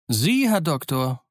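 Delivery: cheerful Silver. A narrator's reading. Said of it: "von bester Laune strahlte".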